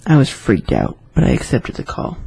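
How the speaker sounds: ordinary delivery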